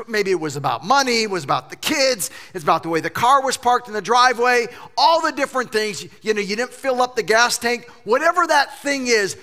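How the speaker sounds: ordinary talk, nothing marked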